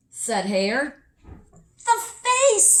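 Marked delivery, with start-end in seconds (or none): 1.8-2.8 much higher and louder; high, loud little-girl discovery; excited girl voice. Acted. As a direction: blurt it out with glee. Nell answers excitedly.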